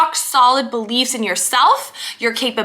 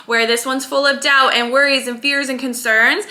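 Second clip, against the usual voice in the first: normal talking voice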